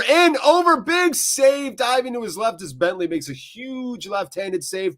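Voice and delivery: high, loud burst on the big save; excited play-by-play. Real, not acted. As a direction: build to a peak as the corner comes in. Live match play-by-play.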